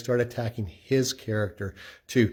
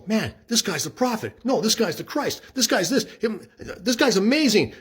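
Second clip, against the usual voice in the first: excited crowd voice; 9 semitones higher than usual. Retold or imitated. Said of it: "people are going".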